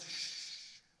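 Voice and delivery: whispered hush; demonstrating a quiet, whispered shush. Retold or imitated. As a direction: hush them like a secret. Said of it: "you're taught to do this".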